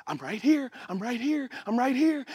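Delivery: soft, repeated reassurance; patient reassurance. Acted. God answering a panicked caller, soft and patient. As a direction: reassure quietly beneath someone's cries. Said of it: "he's like".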